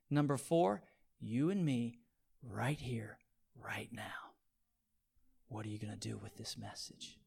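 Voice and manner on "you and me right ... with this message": soft, low, nearly whispered; a quiet, intimate challenge; hushed appeal. Real, not acted. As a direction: lean in and speak it close. Sermon drops to intimate hush.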